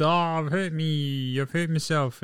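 slow, drawn-out mock whimper of a hurt player; mock whining injury. Podcaster mocking an injured player's complaints, slow mock whine. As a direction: whine it out slowly like you're milking an injury.

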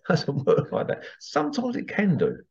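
ordinary delivery

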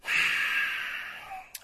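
soft exasperated sigh; long sigh of defeat; long weary sigh. Real, not acted. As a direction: let out a tired breath. Exasperated after the exchange.

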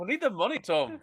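high-pitched mock Northern Irish plea; hammed-up Irish movie-star accent; Brad Pitt's Irish accent. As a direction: put on a bad Irish accent. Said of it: "Brad Pitt's Irish accent".

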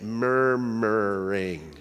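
stretched slow and low, humming the word; slow rumbling Murmuring; drawn-out murmur. Shown as a demonstration. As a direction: let Murmuring. drone out like a grumble. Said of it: "Even the word has a negative, repetitive sound to it".

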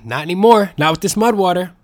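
loud, high, triumphant pitch; excited. Real, not acted. Host hypes Mud Water to his viewers, excited and loud. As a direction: sell it with a burst of excitement.

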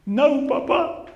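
put-on high, slow, pleading little girl voice; imitating little daughter. Father voicing his daughter begging him not to punish her. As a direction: plead like a small child caught out. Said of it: "She says".

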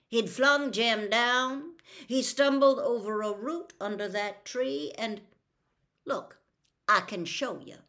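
ordinary delivery

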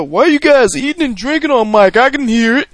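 high-pitched mock complainer; Twitter commenter voice. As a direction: whine it high, like an online nitpicker. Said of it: "That's my Twitter voice".

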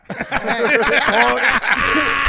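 big real laugh, high; loud laugh. Real, not acted. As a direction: burst out laughing. Laughing at own retelling.

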